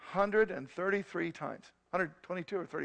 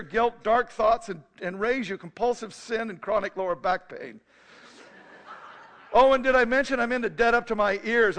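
a loud, higher teasing boom from across the room; 4 semitones higher, 9 dB louder than usual. Retold or imitated. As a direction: boom it out like a challenge. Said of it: "a voice booms from the back".

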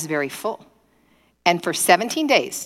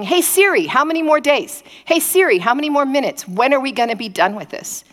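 high-pitched, whiny countdown questions; impatient pleading; 7 semitones higher than usual. Acted. Asking Siri when the hard trip ends, impatient. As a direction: pester the voice assistant for an end.

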